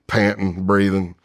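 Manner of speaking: ordinary talk, nothing marked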